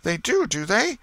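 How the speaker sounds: put-on high, loud knowing old aunt; suspicious aunt. A narrator's reading. Aunt doubts the boy, high and suspicious. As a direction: she smells a lie.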